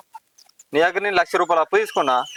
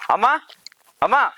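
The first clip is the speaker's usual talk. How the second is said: higher call with a rising end; 5 semitones higher than usual. Acted. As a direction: call mother from the doorway. Son calls his mother from outside. (అమ్మను బయట నుంచి పిలవడం)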